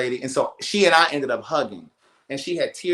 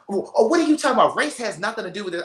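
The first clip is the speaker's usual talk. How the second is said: imitating an indignant woman, high and rushed; indignant retort; 7 semitones higher, faster than usual. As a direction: hurry out a high-pitched mock protest. Retelling an argument, indignant reply.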